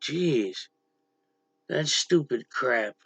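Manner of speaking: ordinary delivery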